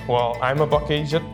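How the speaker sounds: ordinary delivery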